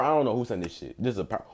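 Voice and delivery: ordinary talk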